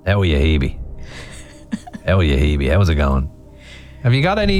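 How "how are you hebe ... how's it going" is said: low put-on Dublin voice; Dublin accent. Host demonstrates the caller's greeting, mimicked Dublin accent. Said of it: "That's a good Dublin accent".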